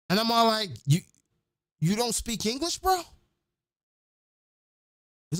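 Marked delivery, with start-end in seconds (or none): 0.9-3.0 high-pitched incredulous question; falter, then ask in high-pitched disbelief; astonished disbelief. Retold or imitated. Traveler questioning the patois-speaking dude, astonished.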